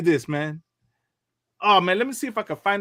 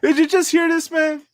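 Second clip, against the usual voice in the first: high, loud, amazed reaction; laugh, then burst out in disbelief; real astonishment, pitched way up; incredulous amusement; 12 semitones higher, 6 dB louder than usual. Real, not acted. Incredulous reaction after clip.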